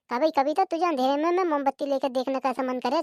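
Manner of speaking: normal talking voice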